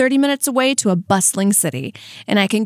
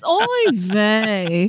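put-on Yiddish accent. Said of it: "he's like".

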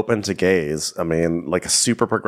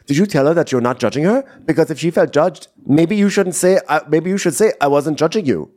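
high fast mock Chanel; 8 semitones higher than usual. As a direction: butt in quick and high. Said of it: "She goes".

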